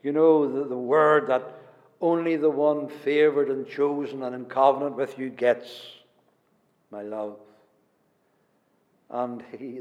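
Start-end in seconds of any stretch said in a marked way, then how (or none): none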